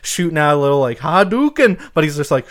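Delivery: normal talking voice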